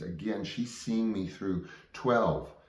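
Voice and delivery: ordinary talk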